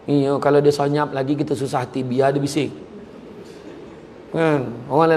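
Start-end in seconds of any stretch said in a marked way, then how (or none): none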